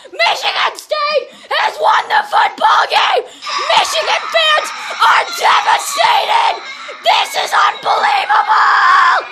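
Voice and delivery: real joyful yelling, pitch climbing; ecstatic shouting. Real, not acted. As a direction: keep screaming the win even as the voice goes. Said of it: "Sorry, I'm losing my voice".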